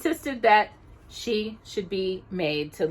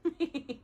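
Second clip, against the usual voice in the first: sneeze; 6 semitones higher, 10 dB quieter than usual. A narrator's reading.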